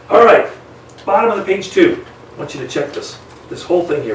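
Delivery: ordinary talk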